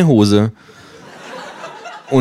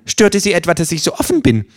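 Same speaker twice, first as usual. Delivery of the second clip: Känguru; 10 semitones higher, faster than usual. Acted. Känguru fragt provokant.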